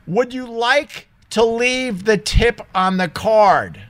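slow, high, deliberate question; mocking cashier quote. Retelling the cashier asking about the tip, mocking. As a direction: imitate her question word by word, slowly.

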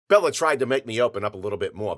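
ordinary delivery

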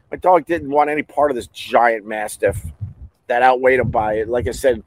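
ordinary delivery

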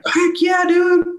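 high-pitched enthusiastic cheer; excited agreement. Real, not acted. Friend cheering a buddy cop film idea, high and excited. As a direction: jump on the idea, voice way up.

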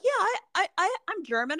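high, hesitant mock claim; a hint of a ditzy wannabe; mocking Orange County person. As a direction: play a clueless suburbanite, lightly. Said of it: "somebody from Orange County's like".

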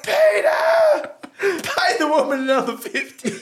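high, loud laughing yell of a name; yelling across. Retold or imitated. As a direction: call out to him across the room, half laughing. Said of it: "yelling out to Peter".

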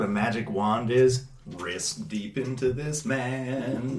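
slow quiet creepy. Acted. GM's character says it slow and low.

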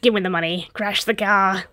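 put-on bossy cat voice; talking cat. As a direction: give orders as a scheming cat. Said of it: "The cat's like".

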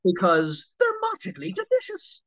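high leprechaun mascot voice; Lucky catchphrase. Acted. Voice actor drops into the leprechaun mascot, chirpy. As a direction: sell it like the cereal mascot. Lucky Charms slogan in character voice.

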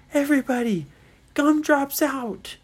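high, loud, stretched-out order; urgent call. Acted. Ryan orders friends to remove earplugs, urgent loud call. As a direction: call out firmly to the whole group.

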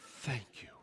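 breathless whispered thank you, dropping to a laugh; astonished preacher whispering grateful thanks to God. Retold or imitated. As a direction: whisper your relief up to heaven. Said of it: "And I'm like".

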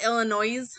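Illinois with a sounded final S, like "noise"; mispronounced Illinois. Shown as a demonstration. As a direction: end Illinois on a buzzing Z. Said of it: "some people call it Illinois".

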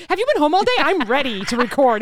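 impatient and keen to start; eager. Retold or imitated. Cohost urging her friend to record, eager. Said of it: "you're like".

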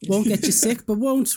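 normal talking voice

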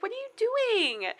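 very high-pitched imitated protest; mock protest. Girl to the friend recording her, mock protest. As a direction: squeal it as a mock complaint.

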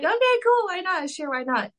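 exaggerated bubbly yes; mock eager agreement. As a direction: play it falsely eager, like a willing puppet.

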